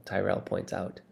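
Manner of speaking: ordinary delivery, nothing marked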